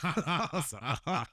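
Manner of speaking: real laughter through the words; laughing as he tells it. Real, not acted. Telling his mate the pizza story, laughing.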